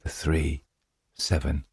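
soft, toneless muttering; a lunatic's quiet, droning repetition with gaps between words; monotonous murmuring. A narrator's reading. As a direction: murmur each card separately, all on one note. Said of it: "constantly murmuring in a monotonous tone".

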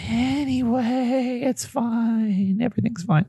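high, slow, strained it's fine; mock it's fine. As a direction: pretend everything is okay, dragging it out. Mock coping after the comments.

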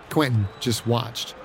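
usual speaking voice

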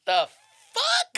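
"fuck" pitched extremely high, rising; pause, then squeal "fuck" as a question; incredulous question. Shown as a demonstration. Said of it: "depending on the inflection".

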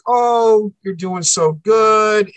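high, sing-song sweet talk, slowed down; sugary fake approval; mock sweet praise. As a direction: coo it like a falsely kind parent. Parents' sweet-talk quoted in story.

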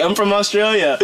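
high-pitched, laughing imitation of an Australian; mock Australian accent. Retelling the gym kid's objection, mock Australian accent. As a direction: mimic an indignant Australian, amused. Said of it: "because he was like".

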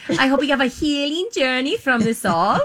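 high, sugary mock-earnest tone; lightly mocking healing-journey voice; mock sincere. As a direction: parody a heartfelt wish. Mocking therapy-speak.

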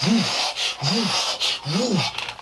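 sharp, high whooshes; sudden coaster-drop whoosh noises; imitated whoosh. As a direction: burst out three loud, high whooshes.

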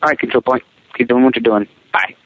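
normal talking voice

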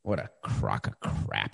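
low, slow whispered grumble; muttered aside. Real, not acted. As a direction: mutter it under your breath in disgust. Disgusted aside after the bit.

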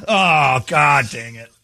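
ordinary talk, nothing marked

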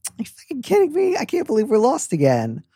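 high, exasperated tipsy whine; lightly exasperated, slightly tipsy. Retold or imitated. Host imagining the letter writer lost at night, high tipsy exasperation. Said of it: "lightly exasperated, slightly tipsy".

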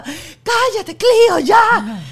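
high, loud mock child's yell; very upset child. Mother imitating her son yelling at his sister, frantic. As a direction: snap at your sister, high and worked up. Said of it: "súper alterado".

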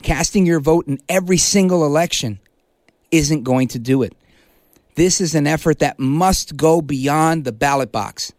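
ordinary delivery

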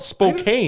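Spokane as spo-CANE, high and drawn out; spelling pronunciation. Shown as a demonstration. Host protesting the odd spelling, Spokane as spo-CANE. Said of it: "it's spelt weird".

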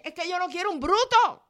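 high-pitched mock whine about a brute; mocking a fed-up ex-girlfriend in a high voice; high mock complaint. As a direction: whine it up high, pretending to be her. Voicing a woman, high.

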